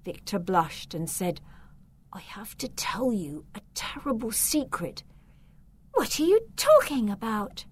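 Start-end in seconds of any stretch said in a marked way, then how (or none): 2.1-5.0 hushed, breathless confiding; boy whispering a secret to the girl; whispered secret. Acted. 5.9-7.6 higher, surprised question; girl puzzled by the boy's warning, surprised. Acted. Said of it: "said the girl in a surprised voice".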